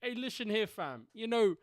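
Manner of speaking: high, loud London roadman; roadman British voice. Shown as a demonstration. Co-host showing off birthday greeting, roadman British voice. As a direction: hype him up in a British roadman accent. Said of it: "I could do a roadman British voice".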